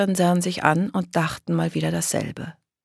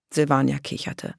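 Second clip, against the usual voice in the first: ordinary delivery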